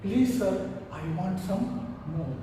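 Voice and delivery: small, timid plea, low and soft; hushed, lightly acted fearful request; frightened. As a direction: ask meekly, as if scared of the master. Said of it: "in a little frightened voice he said".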